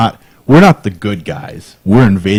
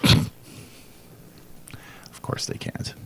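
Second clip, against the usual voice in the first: muttered aside; 14 dB quieter than usual. Real, not acted. Muttered aside after Polish remark.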